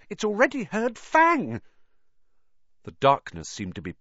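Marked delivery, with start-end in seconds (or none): 0.1-1.6 high, loud panicky squeal; unnaturally high voice. A narrator's reading. Ron telling Harry they are already heard, unnaturally high voice. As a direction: stay up at a squeaky, frightened pitch. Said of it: "in an unnaturally high voice".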